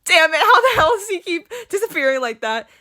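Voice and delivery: high, exasperated villain rant; frustrated disbelief. A narrator's reading. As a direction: blurt it in acted disbelief. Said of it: "gazes in disbelief".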